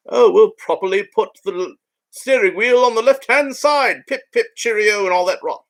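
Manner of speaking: high, hammed-up posh English accent; mock a stuffy British gent in a bad English accent; terrible English accent. Mocking the British land speeder builders, terrible high English accent. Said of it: "It's like this terrible English accent".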